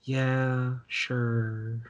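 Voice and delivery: softly trailing, absent-minded agreement; distracted. Distracted friend half-answering while not paying attention, absently. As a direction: answer with your mind elsewhere. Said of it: "she's not paying attention".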